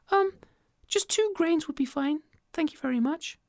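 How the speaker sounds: very high, piping call; a tiny creature calling up; squeaky tiny voice; tiny ant voice. Acted. Ant asks small payment.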